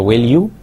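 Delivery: "will you" with a sharp upward glide on "you"; rising tone. Shown as a demonstration. Teacher isolating the tag "will you" with a rising tone. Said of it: "will be said with the rising tone".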